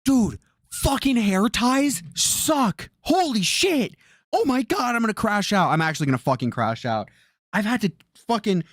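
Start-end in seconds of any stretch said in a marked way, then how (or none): 0.0-5.7 higher, dragged-out groan of frustration at hair ties; fed up with hair ties, higher and slow; exasperated outburst. Real, not acted. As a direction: vent it, slow and pitched up. Frustrated rant about hair ties.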